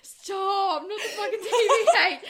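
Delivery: high, loud laughing protest; laughing shriek. Real, not acted. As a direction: squeal it out through laughter. Cringing at old Snapchat trend.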